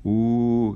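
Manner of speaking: loud, high "uuuh"; mock jeering hoot; long teasing cry from a crowd; group jeer. A narrator's reading. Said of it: "gritaron todos".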